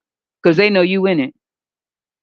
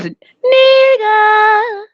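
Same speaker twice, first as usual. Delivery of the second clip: high drawn-out call; mocking a drawn-out greeting; 13 semitones higher, 3 dB louder than usual. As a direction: stretch it out like a shout across the lot.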